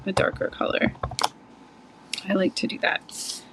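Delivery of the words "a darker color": whispered confiding aside. Real, not acted. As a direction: lean in and whisper it like a little secret. Whispered aside while stamping.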